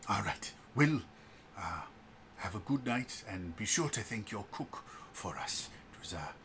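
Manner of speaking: soft, measured officer addressing the group; brief them quietly and formally; Declan. Acted. Game master voices Declan.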